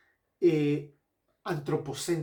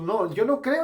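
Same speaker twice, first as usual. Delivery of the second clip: emphatic protest; 7 semitones higher, 7 dB louder than usual. Retold or imitated. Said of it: "dice Habacuc".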